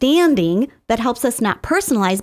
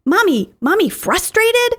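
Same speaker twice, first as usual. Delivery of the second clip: put-on higher, light little-kid question; toddler voice, questioning; 9 semitones higher than usual. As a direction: voice a small child asking about mom. Toddler repeats mom's feeling word.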